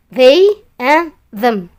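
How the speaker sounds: "they and them" slowly, each th voiced; modelled pronunciation. Shown as a demonstration. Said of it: "ini dibacanya they".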